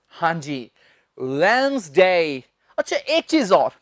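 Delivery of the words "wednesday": वेडनेसडे as slow two-beat WENZ-day, silent D; correct pronunciation. Shown as a demonstration. Teacher models वेडनेसडे for students, careful correct wenz-day with a long glide. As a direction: draw out वेडनेसडे as wenz-daay, falling. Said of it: "डी नहीं बोलनी".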